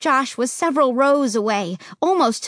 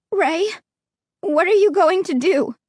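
scared, higher pitch; frightened high plea; 6 semitones higher than usual. Acted. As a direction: beg him fearfully. Terrified plea.

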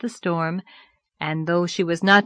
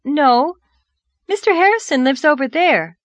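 higher, louder astonished reply; 8 semitones higher, 6 dB louder than usual. A narrator's reading. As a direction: answer as if lost in amazement. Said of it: "quite lost in astonishment".

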